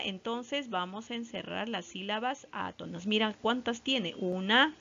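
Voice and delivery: ordinary talk, nothing marked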